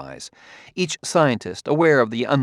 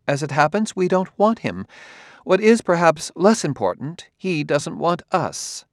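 higher-pitched, crisp voice of a scientist; cool Vasilia; 7 semitones higher than usual. A narrator's reading. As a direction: voice her higher, coolly dismissive.

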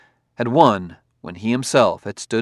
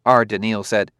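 ordinary delivery

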